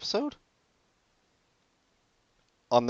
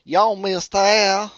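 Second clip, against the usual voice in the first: slow, drawn-out Southern accent, high and loud; 11 semitones higher, 8 dB louder, much slower than usual. Shown as a demonstration. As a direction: stretch "Y'all" in a broad Southern accent. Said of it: "can do a Southern accent".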